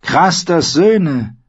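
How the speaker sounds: high-pitched, loud crone's warning; foreboding old woman. Acted.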